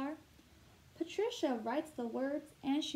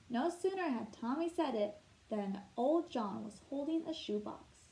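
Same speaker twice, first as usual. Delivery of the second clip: usual speaking voice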